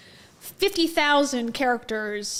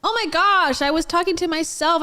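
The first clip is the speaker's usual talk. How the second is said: startled; 4 semitones higher, 5 dB louder than usual. Real, not acted. Realizes the stream was muted.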